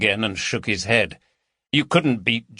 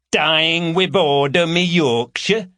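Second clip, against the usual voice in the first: put-on overdone Yorkshire accent, higher and louder; grotesque Yorkshire parody; 7 semitones higher, 4 dB louder than usual. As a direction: ham up a broad Yorkshire accent. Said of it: "a grotesque parody of a Yorkshire accent".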